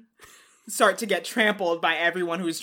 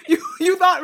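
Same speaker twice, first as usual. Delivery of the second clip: high, loud, laughing stammer; helpless laughter breaking up the words; cracking up; 10 semitones higher, 7 dB louder than usual. Real, not acted. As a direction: stumble over the words while laughing hard. Cracking up over the date mix-up.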